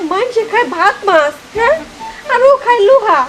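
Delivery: ordinary delivery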